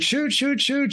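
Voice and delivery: put-on egging the boy on to fire; eager hunters urging. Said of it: "they're like".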